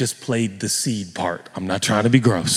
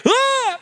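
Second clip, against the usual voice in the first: sudden high yelp; mock sneeze cry; 4 dB louder than usual. Pastor acting out a sneeze in the airport, yelping. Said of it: "you're like".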